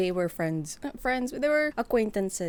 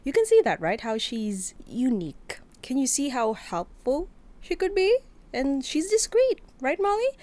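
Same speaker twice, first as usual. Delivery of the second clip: bright, much higher and louder sell; high excited; 6 semitones higher, 5 dB louder than usual. Acted. Rodney showing off Molly to the men, much higher. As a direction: pitch her like a prized find. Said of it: "That's what Rodney said".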